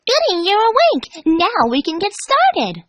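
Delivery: high, slow and delighted; gleeful. A narrator's reading. Pinkie greeting the strapped-down Dash, gleefully high. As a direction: sing it out with creepy glee. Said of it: "Pinkie stated gleefully".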